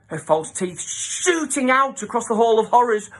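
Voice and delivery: plain reading voice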